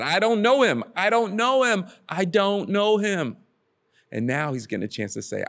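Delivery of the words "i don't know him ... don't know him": higher, insistent mock denial; retold cursing denial. Peter cussing and denying he knows Jesus, retold insistent denial. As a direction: act out a vehement denial, slowing on the last one. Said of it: "he had cussed and said".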